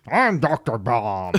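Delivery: drawn-out villain voice sample imitation; slow, hammed-up game character voice; Doctor Bomb. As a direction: stretch it out like a game villain announcing himself. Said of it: "a voice sample that goes".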